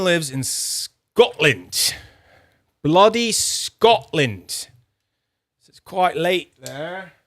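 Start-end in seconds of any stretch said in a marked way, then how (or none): 2.8-7.1 failed Scottish accent. Shown as a demonstration. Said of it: "That was not a Scottish accent".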